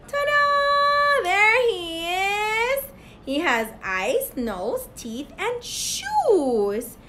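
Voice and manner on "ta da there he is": high, drawn-out fanfare; ta-da reveal. Pretend. Teacher showing Mister Carrot to children, triumphant reveal. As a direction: present it with a high, stretched ta-da.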